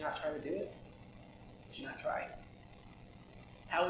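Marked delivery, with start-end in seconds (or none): 1.7-2.4 soft breathy echo of the question; whispered repeat of "Would you not try it?". Shown as a demonstration. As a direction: drop to a whisper to let it sink in.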